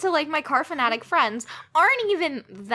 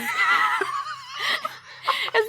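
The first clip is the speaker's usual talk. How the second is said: burst of laughter; 12 semitones higher than usual. Real, not acted. Bursts out laughing mid-rant.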